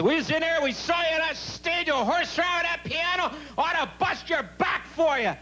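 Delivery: high-pitched mock lady, scolding hard; shrill angry lady. An angry lady scolding the comic after his show. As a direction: play the furious old lady chewing him out. Irate lady customer berating him.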